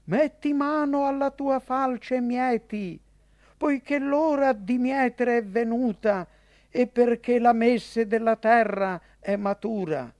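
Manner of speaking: solemn shouted command, read aloud; crying out loudly. A narrator's reading. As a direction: call out the command in a high, ringing narrator's cry. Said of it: "gridando a gran voce".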